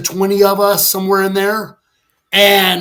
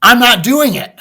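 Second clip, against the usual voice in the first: confrontational refusal; 4 semitones higher than usual. Retold or imitated. Airman refusing supervisor's order to repaint, defiant. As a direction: square up and refuse, voice raised.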